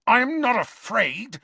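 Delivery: high, stung denial; cornered boy snarling at the headmaster; snarled. A narrator's reading. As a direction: snap back defiantly. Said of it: "snarled Malfoy".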